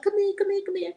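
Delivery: put-on playful monk calling a toddler; coaxing a toddler. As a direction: coax the baby over, bright and high.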